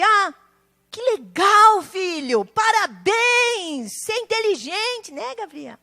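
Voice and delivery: proud dad congratulating Gabriel, lightly acted; celebrating parent. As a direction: cheer on the son like a proud dad. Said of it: "Atitude de celebração".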